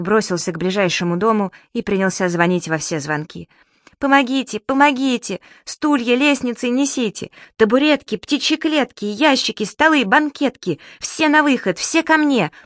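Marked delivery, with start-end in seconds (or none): 4.0-12.5 calling at full power. Acted. Субастик rings doorbells and calls the neighbours, full power. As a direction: rouse the whole street. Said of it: "гласил Субастик во всю мощь".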